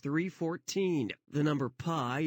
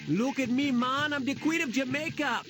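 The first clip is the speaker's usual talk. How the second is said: put-on Jamaican accent; 8 semitones higher than usual. Queen voiced in Jamaican accent.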